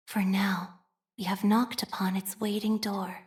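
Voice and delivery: hushed scripture whisper. Acted. Devout whisper to the faithful about their lost home. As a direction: breathe the words like a secret prayer.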